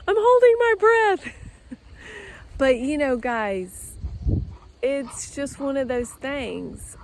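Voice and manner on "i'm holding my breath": high, loud half-laughing squeal; nervous squeal through held breath and laughter; freaked out. Real, not acted. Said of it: "it's kind of freaking me out".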